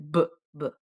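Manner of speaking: short, popped "b" as buh; isolated B sound. Shown as a demonstration. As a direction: sound out "b" twice, separately. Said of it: "exactly like you would say".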